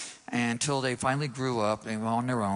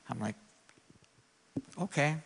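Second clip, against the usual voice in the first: ordinary talk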